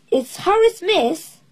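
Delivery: put-on a child spotting a favourite visitor; excited. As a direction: announce him with glee. Chip excited to see Harry.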